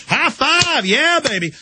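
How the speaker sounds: high, hammed-up gloating cheer; mock cheering thug. Thugs mocked as cheering the beating, high and hammed-up. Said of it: "And they're like".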